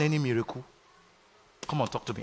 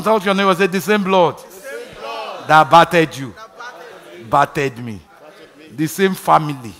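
loud fervent preaching; preaching at full force; 11 dB louder than usual. Acted. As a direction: drive it home to the church. Preaching to the congregation.

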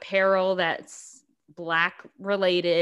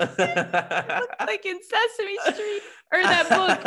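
laughing; 11 semitones higher than usual. Real, not acted. Laughing while describing the shirt.

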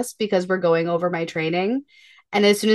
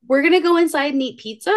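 put-on light child voice; 6 semitones higher, 3 dB louder than usual. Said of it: "Reagan goes".

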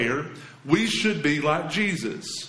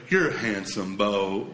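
lower, grudging, lightly voiced child; forced compliment; 8 semitones lower, slower than usual. Imitating a kid forced to compliment her brother. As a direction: say it like a kid made to be nice. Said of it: "It's forced".

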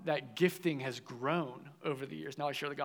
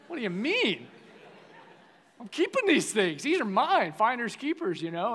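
loud, high mock outrage; retold indignant outburst; 7 semitones higher, 9 dB louder than usual. Teenager refusing to hand found sunglasses to his leader, indignant. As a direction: protest loudly like a possessive teenager. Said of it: "I was so mad".

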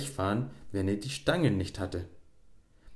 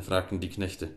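usual speaking voice

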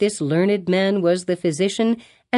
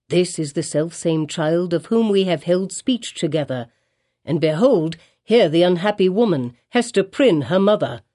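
usual speaking voice

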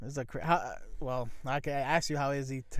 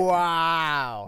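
loud, long-held wow; exaggerated wow; 8 dB louder than usual. Real, not acted. Co-host ribbing a friend who dropped the ball, exaggerated wow. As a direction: draw out a big, loud wow. Teasing co-host over a mistake.

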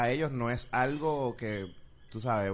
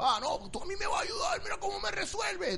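much higher, mock whiny caller; pushy customer impression; 5 dB quieter than usual. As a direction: squeak out a demanding caller's complaint. Imitating pushy local caller.

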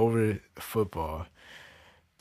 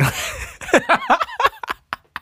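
very high, loud cackle; laughing; 6 dB louder than usual. Real, not acted. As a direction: crack up in a long squealing laugh. Cracks up at own remark.

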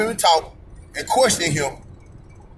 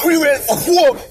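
a hammed-up battle yell, higher and louder; mock war cry; 6 semitones higher, 3 dB louder than usual. To viewers, mocking protesters who yell for war, hammed-up yell. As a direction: belt it out like a crowd chant, mocking it.

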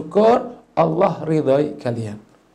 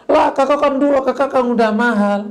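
put-on high, louder childish retort; complaining younger sibling; 9 semitones higher, 4 dB louder than usual. Younger sibling argues with older one over food, high loud complaint. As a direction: argue back as the little sibling. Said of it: "adiknya bilang".

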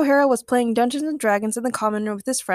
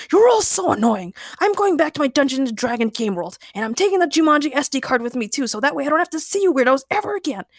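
high, loud rant getting faster; annoyed shouting; 5 semitones higher, 3 dB louder, faster than usual. A narrator's reading. Villain tells the weirdos he is leaving, shouting. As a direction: storm off with a petulant tirade. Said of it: "Ray shouted".

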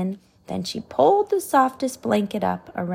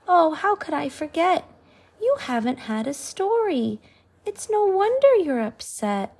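put-on bright little girl voice, a touch higher; a hint of a cheerful young heroine; lightly voiced girl; 7 semitones higher than usual. Sophie's voice in story.

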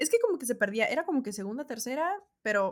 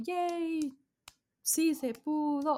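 excited cheer; 6 semitones higher, much slower than usual. Retold or imitated. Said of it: "estábamos ahí de que".

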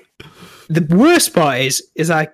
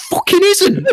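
indignant retort; 14 semitones higher than usual. Retold or imitated. Angry retort to southerners.

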